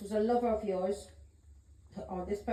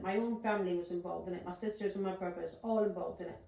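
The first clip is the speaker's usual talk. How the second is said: ordinary talk, nothing marked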